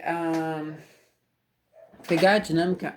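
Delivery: normal talking voice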